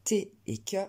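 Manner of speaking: ordinary delivery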